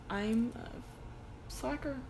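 soft, slow self-mocking admission; self-deprecating. Real, not acted. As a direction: admit it sheepishly, slowly. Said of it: "I'm looking back at and I'm like".

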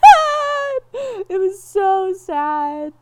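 high, loud, tearful wail; real crying, slow and high. Real, not acted. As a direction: let the tears break through every word. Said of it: "you're literally crying right now".

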